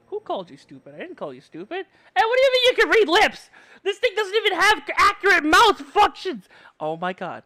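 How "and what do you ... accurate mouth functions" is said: high, loud indignant rant; outraged yell. Real, not acted. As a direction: shout at chat in disbelief. Outraged at chat reading lips.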